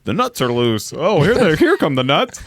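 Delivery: lightly hammed-up announcer call, rising pitch; excited mock announcer line; parade announcer. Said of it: "the Macy's Day announcer".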